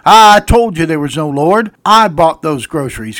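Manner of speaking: loud acted outburst; gloating neighbor. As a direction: jump out and crow it triumphantly. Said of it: "the neighbor jumped out from behind the bush".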